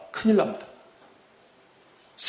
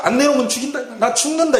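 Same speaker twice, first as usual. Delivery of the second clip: high-pitched mock tantrum demand; imitated desperate threat; 7 semitones higher, faster than usual. Preacher mimicking people who wring their guts in prayer, mock desperate threat. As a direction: act out a gut-wrenched, high-pitched ultimatum. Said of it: "창자를 있는 대로 다 비틀어가지고".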